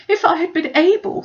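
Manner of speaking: high, loud, fretful complaint; Mrs Bennet fretting. Acted. Mother lamenting to her family, high and fretful. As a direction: whine it out like a frantic mother.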